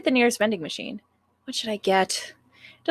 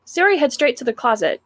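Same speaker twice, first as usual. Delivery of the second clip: ordinary talk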